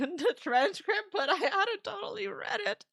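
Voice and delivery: talking through giggles; real laughter. Real, not acted. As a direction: struggle to get the words out while laughing. Laughs recalling the transcript.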